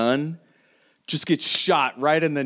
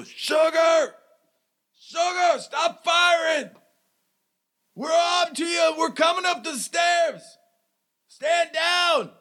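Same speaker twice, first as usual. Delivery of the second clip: high, strained shout up a stairwell; shouting from downstairs. Acted. Man shouts up from flights below to stop the firing, high strained shout. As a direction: call out far above the usual pitch, like a distant yell. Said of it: "You guys hear shouting from".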